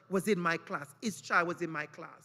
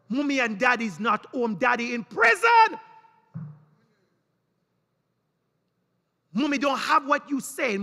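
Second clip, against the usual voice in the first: impassioned inner voice; 2 semitones higher, 7 dB louder than usual. Retold or imitated. Teacher's conscience crying out about the boy, loud. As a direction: plead the boy's case, building to a shout.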